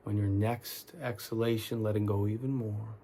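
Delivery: soft, unhurried meditation guide with long pauses; calm, quiet guiding voice; guided meditation. Real, not acted. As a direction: talk them gently through tensing and letting go, leaving space between phrases. Leads guided relaxation meditation.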